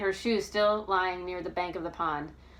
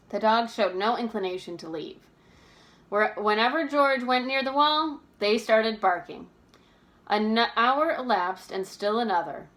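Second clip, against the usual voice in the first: usual speaking voice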